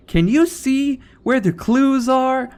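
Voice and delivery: put-on high, drawn-out kids' show host. Host asks young viewers to spot clues, high singsong.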